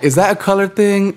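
high mocking question. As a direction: ask it high, feigning suspicion. Voicing people asking.

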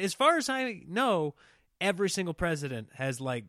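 usual speaking voice

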